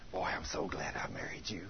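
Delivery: low, very soft and intimate; tender sweet-talk. Acted. Coaching a wife to hug her husband, soft tender murmur. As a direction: murmur it lovingly, close by.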